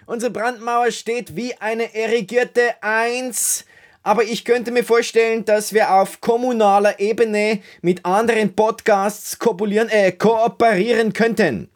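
high, thin Viennese voice greeting; high Viennese sidekick. Acted. Peter greets the listeners in a high Viennese voice. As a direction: play the Wiener sound tech Peter, high.

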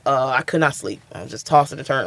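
normal talking voice

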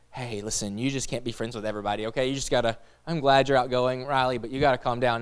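a dad quietly warning Riley to calm down; gentle parental warning. Retold or imitated. Dad gently cautioning daughter Riley, soft and fast.

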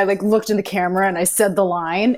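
ordinary delivery

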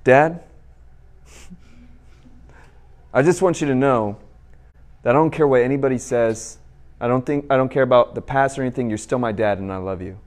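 soft, sincere and tender, with long dramatic pauses; heartfelt dramatic. Retold or imitated. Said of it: "Dramatic pause, very key".

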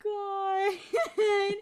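high, loud, stretched-out wail into laughter; overcome with laughter. Real, not acted. Host overcome by foreshadowing, laughing wail. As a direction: wail it slowly, breaking into laughs.

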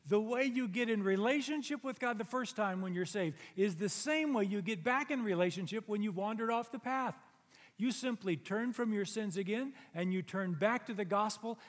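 loud, high preaching voice; full preaching. Acted. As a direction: drive the point home from the pulpit.

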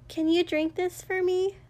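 high-pitched gentle request; interrupting. A narrator's reading. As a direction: cut in with a lifted, coaxing voice. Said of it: "Ochako interrupted".

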